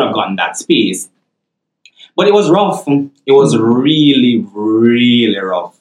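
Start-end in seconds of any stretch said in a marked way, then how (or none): 2.9-5.8 REALLY stretched long and slow, both times; drawn-out emphasis. Real, not acted. Student admitting how hard the master's was, drawn-out emphasis. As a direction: draw out each "really" before "rough".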